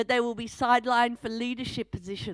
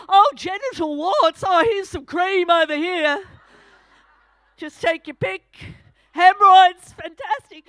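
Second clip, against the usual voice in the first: breezy chemist; 4 semitones higher, 8 dB louder than usual. Retold or imitated. Imitating cheerful chemist offering creams.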